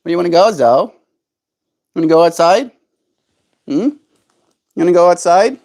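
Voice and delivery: high sing-song pet talk. Acted. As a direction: coax a pet gently. Coaxing pet bird outside.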